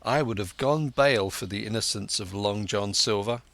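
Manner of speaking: ordinary delivery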